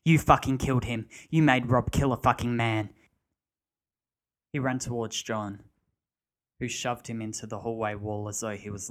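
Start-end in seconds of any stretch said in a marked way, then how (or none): 0.0-2.9 furious yell. A narrator's reading. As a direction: hurl the blame at him. Barry shrieking at John.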